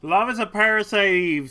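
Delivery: loud and proud, right up close; loud declaration. Pretend. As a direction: belt it out up close. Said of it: "says Marie".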